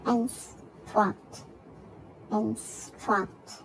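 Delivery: "Instruct" split by a gap, in, struct; syllable by syllable. Shown as a demonstration. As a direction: give "Instruct" as two separate beats. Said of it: "two syllable".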